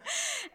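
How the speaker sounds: short high giggle; high laugh. Real, not acted. Laughs after Florida joke.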